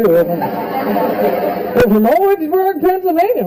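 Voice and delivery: slow, drawn-out proud boast, rising high on Pennsylvania; mock bragging. Comic mimicking birthday group bragging about their hometown, mock proud. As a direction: stretch the name out like a proud local. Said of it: "they're, like, bragging about it too".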